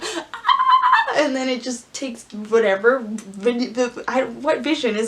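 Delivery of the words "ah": high laughing yelp; a squeaky fake scream; mock cry. Retelling Rey's shock, high laughing yelp. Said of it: "and then Rey's like".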